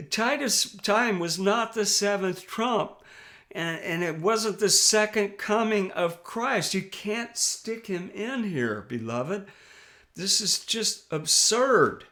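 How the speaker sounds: preacher's pitch rising in real indignation; worked up. Real, not acted. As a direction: get fired up against the false teaching. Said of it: "pardon me if I get a little worked up".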